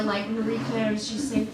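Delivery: usual speaking voice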